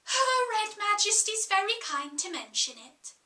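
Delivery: a hint of cooing sweetness; cooing murmur. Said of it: "like the cooing of a pigeon".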